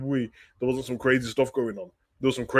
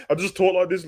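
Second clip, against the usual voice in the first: laughing; 8 semitones higher than usual. Real, not acted. Laughing at chat about his catchphrase.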